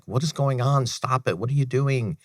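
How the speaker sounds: retelling his wife begging him to stop; upset crying wife. Retold or imitated. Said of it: "she's, like, crying".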